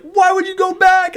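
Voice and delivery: very high, loud protest; mock yelling. Player re-enacting disbelief at going back, mock yelling. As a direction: push the squabble to its loudest peak. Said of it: "we don't have to start yelling".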